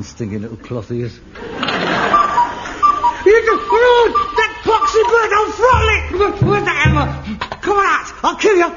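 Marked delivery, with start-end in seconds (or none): 3.4-7.1 much higher, louder enraged yelling; furious shout. Acted. Raging at the cuckoo clock. 7.6-8.1 high, loud yell at the clock; angry shout. Acted. Shouting at the cuckoo. 8.2-8.7 high, loud enraged shout; furious threat. Acted. Threatening the cuckoo.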